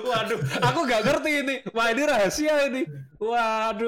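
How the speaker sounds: cracking up at the secret trick; laughing. Real, not acted. Host laughs at his guest's martabak trick for calming in-laws.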